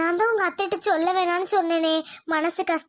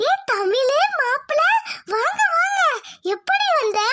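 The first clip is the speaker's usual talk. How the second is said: surprised joy; 8 semitones higher, slower than usual. Acted. Mother surprised to see daughter.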